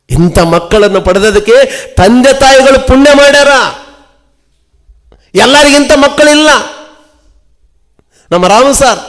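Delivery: high, loud pulpit warning; fiery preaching. Acted. Pastor warning parents about their children, preaching at full force. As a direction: thunder it at the congregation like a revival preacher.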